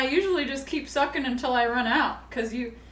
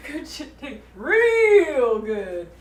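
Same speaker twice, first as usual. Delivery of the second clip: slow emphatic; 5 dB louder, much slower than usual. Acted. Stretched punchline.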